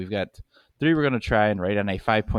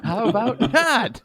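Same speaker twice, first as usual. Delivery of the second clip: mock refusal; 14 semitones higher, 4 dB louder, slower than usual. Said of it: "mock reply to hug offer".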